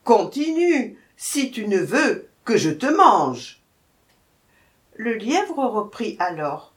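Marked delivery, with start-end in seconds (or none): 0.0-3.6 loud threatening command; menacing order. A narrator's reading. Lioness threatening the hare to continue, menacing. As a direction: order it with a threat of eating him. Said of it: "ordonna la lionne au lièvre".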